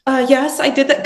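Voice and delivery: partner's curt answer, mildly imitated; a hint of an annoyed yes; flat-sounding reply. Partner answering her, sounding a little curt. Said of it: "she thinks I'm angry".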